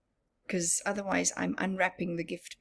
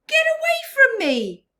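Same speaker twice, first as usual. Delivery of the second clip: very high, loud, fast shout; angry shout; 11 dB louder, faster than usual. Acted. Rainbow Fish shooing the little fish away, angry shout. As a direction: drive him off with a shout. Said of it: "cried the Rainbow Fish".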